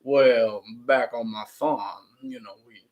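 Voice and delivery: slow, low Southern farmer drawl; cartoon Southern accent. Imitating the one guy met in Georgia, exaggerated Southern accent. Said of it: "you meet the one guy who's like".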